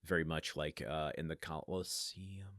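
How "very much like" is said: plain reading voice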